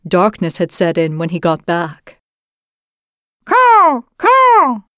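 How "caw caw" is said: high, loud cawing; loud crow caw. A narrator's reading. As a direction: call out like a crow. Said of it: "he said".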